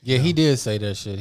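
usual speaking voice

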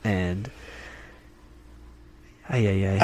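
losing the thread; trailing off. Real, not acted. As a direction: voice trails off, lost in thought. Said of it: "I lost my train of thought".

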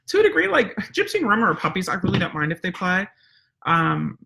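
ordinary talk, nothing marked

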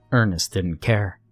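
usual speaking voice

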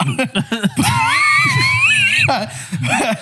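real shrieking laugh; helpless laughter. Real, not acted. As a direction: crack up with a long, high squeal of laughter. Slip of words sets off laughter.

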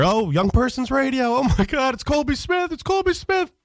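rising star-struck cheer; cheering fans. Retold or imitated. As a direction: gush like a starstruck crowd. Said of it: "people would cheer".

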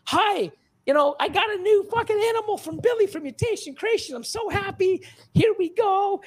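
high-pitched, gushing fake enthusiasm; hammed-up thrilled customer in a high voice; mock-excited unboxer. Buyer greeting viewers of an unboxing video, mock excited.

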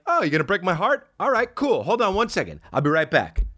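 high, breezy fake-casual voice; mock casual. Comedian acting out hiding heartbreak, breezy and high. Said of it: "You're like".

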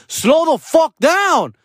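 high, drawn-out acted command; retold angry yell. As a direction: stretch it out in mock outrage. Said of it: "I was mad".

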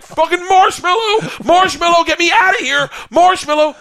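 very high, loud mock-terrified yelling; hammed-up desperate shouts for rescue; panicked screaming. Screaming the safe word under a grinding bear, panicked.

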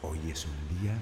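distant, very soft repeat of an affirmation; fading echo. Acted. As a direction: let each repeat recede further away.

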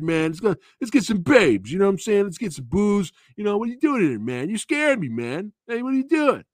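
high, loud rowdy bro voice; rowdy brother. Retold or imitated. Younger brother pestering his brother in the pet store, high rowdy voice. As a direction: egg him on like a wild big brother.

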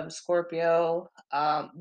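usual speaking voice

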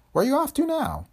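high-pitched voice for a worried colleague; camera expert's voice. A narrator's reading. Cameraman asking Tony about trouble, high character voice. Peters questions Quaid in dialogue.

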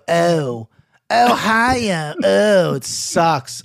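lightly hammed-up Ohio accent, stretched out; a mild mock of the Ohio way of talking; teasing imitation of his own Ohio accent. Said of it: "The Ohio accent sucks".

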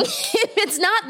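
giggling start of a joke; laughing. Real, not acted. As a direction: laugh breaking into the words. Laughing reply to cohost's plug.

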